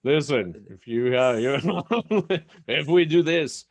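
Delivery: high-pitched hammed-up French accent. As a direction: put on a silly French accent, breaking into a laugh. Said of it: "he has a French accent".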